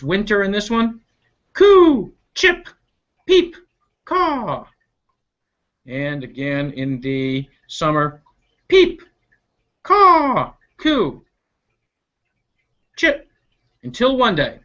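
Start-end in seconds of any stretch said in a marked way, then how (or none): 1.5-4.7 high chirps and caws in turn; bird calls. Acted. 8.7-13.3 high chirps and caws in turn; bird calls. Acted.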